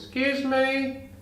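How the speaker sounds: high, slow timid rabbit asking politely; talking rabbit. Acted. Joke, rabbit speaks to barman.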